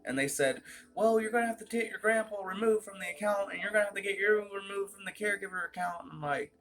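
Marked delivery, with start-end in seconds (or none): 0.9-6.0 fast, higher-pitched imitation of staff; mimicked staff reply. Account staff explaining removal to the caller, mimicked high and fast. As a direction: rattle off the instructions as a mimicked clerk.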